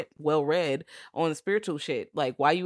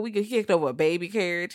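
ordinary talk

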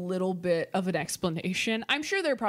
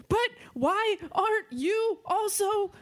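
high, slow whiny plea; 13 semitones higher, much slower than usual. Retold or imitated. Quoting herself to boyfriend.

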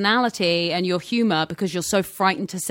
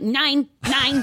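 mock German; 7 semitones higher than usual. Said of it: "they're like".

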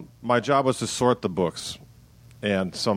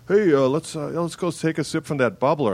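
lightly acted hail, pitched up; casual local invitation; 8 semitones higher than usual. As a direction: imitate a chirpy local hailing a friend. Acts out someone in Tasmania inviting him.

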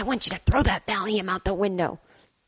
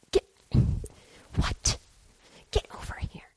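hushed urgency; 5 dB quieter than usual. Acted. Urgent hushed call to Steve.

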